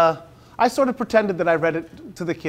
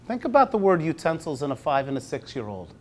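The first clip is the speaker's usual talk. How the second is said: ordinary talk, nothing marked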